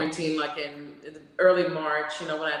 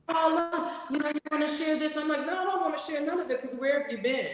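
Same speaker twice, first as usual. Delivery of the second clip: high mock voices; 9 semitones higher than usual. Mimicking people sharing.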